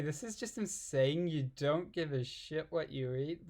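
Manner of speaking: ordinary talk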